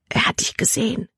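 urgent, voiceless whisper. A narrator's reading. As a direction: whisper a warning to a companion. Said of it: "flüsterte sie". (eindringlich geflüstert)

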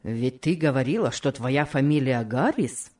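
low man's voice, questioning; husband figure, inquiring. Acted. Host asking the guest about her surname, low and inquiring.

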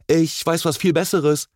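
high-pitched, forceful interjection; energetic call. A narrator's reading. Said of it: "rief Enzo energisch".

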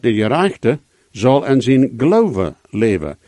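"geloven" picked out with a falling emphasis; stress on GELOVEN. Shown as a demonstration. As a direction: put all the weight on "geloven". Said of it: "De ganze betoning is op den geloven".

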